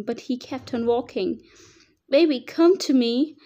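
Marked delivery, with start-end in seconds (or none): 2.1-3.4 put-on high, loud shout after her child. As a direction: shout across the distance to your baby. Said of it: "I shouted".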